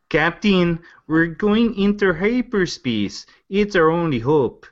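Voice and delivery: high, drawn-out attempt at a Minnesota accent; exaggerated Minnesota lilt, slow and high; Fargo accent. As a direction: do a wobbly Fargo accent, pitched well up. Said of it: "my version of what the guys from Fargo talk like".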